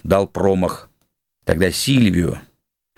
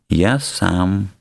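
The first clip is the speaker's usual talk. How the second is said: usual speaking voice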